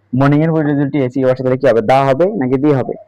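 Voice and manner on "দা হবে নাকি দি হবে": usual speaking voice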